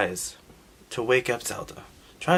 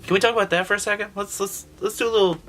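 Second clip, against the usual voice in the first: eager rant; 9 semitones higher, faster than usual. Real, not acted. Ranting about the sleep spell.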